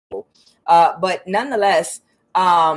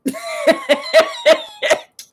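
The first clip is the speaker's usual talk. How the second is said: high-pitched real laughing fit; high cackling laughter; 5 dB louder than usual. Real, not acted. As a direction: crack up at your own story. Laughs at her own story.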